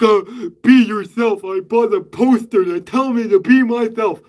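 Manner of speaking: mock a corny motivational poster; mocking cheesy voice. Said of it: "cheesy, like".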